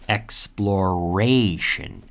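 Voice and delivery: "Exploration" drawn out, lingering on RAY; slow model pronunciation. Shown as a demonstration. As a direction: stretch "Exploration" for learners to copy. Teacher models word slowly.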